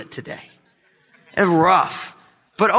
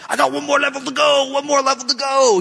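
put-on high whiny child begging for one more level; pleading kid; 8 semitones higher than usual. Son begs dad to keep playing, high pleading whine. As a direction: lightly whine it like a seven-year-old.

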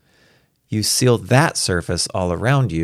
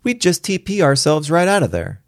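put-on high, gleeful child voice; Star; 10 semitones higher, 4 dB louder than usual. A narrator's reading. Child boasting of escape to her daddy, gleeful. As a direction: pitch it up like a delighted little girl. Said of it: "Star shrilled in glee".

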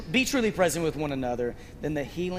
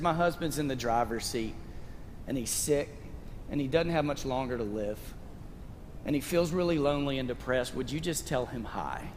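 put-on soft, lower, hushed request from a frail old woman; quiet old lady's plea; 4 semitones lower, 6 dB quieter than usual. The old lady asks the preacher to greet her dying husband, softly. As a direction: voice her gently, softer and lower.

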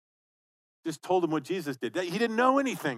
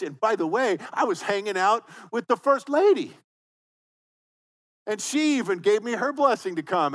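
boastful, louder and higher; bragging; 5 semitones higher, 4 dB louder than usual. Retold or imitated. Pastor imagining himself bragging to his accusers, boastful. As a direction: lay it on thick, showing off. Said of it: "Brett would have said".

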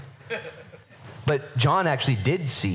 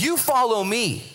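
1 firm Jesus; 11 semitones higher than usual. Retold or imitated. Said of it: "He says something completely sarcastic".

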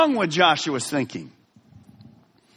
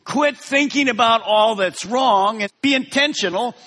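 higher and louder, change drawn out slowly; full-force preaching; 7 semitones higher, 4 dB louder than usual. Acted. Preacher urging the congregation to change, full force. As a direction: drive the call home to the congregation.

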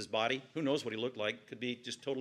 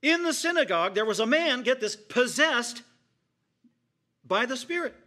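full-force preaching; 6 semitones higher, 5 dB louder than usual. Real, not acted. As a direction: cry out the shocking scene.

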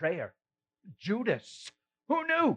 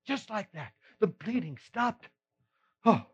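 ordinary talk, nothing marked

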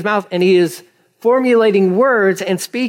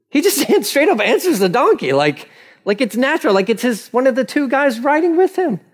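ordinary talk, nothing marked

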